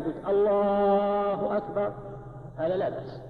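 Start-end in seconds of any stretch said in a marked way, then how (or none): 0.3-1.9 الله أكبر slowly as "allaaaahu akbar"; drawn-out الله, then short أكبر; long vowel after lam. Shown as a demonstration. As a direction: hold the second A of الله on a level tone. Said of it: "لو مددت الألف التي بعد اللام".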